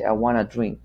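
"want to" merged into "wanna"; American contraction. Shown as a demonstration. Teacher showing how Americans normally say it, contracted "wanna". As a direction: say "wanna" as one linked word. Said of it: "el americano dice".

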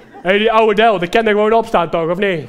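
loud, careless dismissal; brash acted smoker. Smoker sitting on the laptop brushes it off, brash and loud. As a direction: call it out loudly, brushing off the worry.

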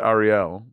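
ARIEL with a tapped R and a clear -el ending; accented pronunciation. Real, not acted. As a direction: say Ariel as "ah-dee-EL". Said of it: "that's how I say it".

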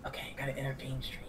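soft, voiceless whisper. Real, not acted. Player restarting the scream-detecting game, whispering. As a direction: keep it under the mic's threshold. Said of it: "when I'm whispering like this".

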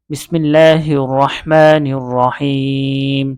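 slow, drawn-out Arabic recitation; slow tajweed-style Bismillah; Quranic recitation. Real, not acted. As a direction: recite it slowly and reverently. Teacher opens Quran recitation.

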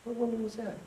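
acted groggy confusion; sleepy monk. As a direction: ask it half asleep, rising at the end. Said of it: "Sleepy monk says".

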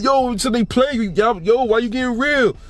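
a hint of a pleading friend; friend's protest. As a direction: play the big friend whining why you getting real. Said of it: "He's like".

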